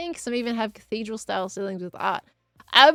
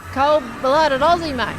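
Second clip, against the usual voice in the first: put-on slow, loud, broad Australian accent; hammed-up Aussie accent; 3 semitones higher, 7 dB louder, slower than usual. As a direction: lay the Aussie accent on thick, playfully. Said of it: "Can't be putting on no accent".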